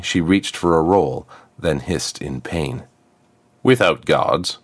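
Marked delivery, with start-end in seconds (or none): 3.6-4.6 sharp. Acted. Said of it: "The crown's voice was sharp".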